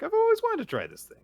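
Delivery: high, loud, quick burst; eager mock excitement. Woman eager to try acupuncture, high and excited.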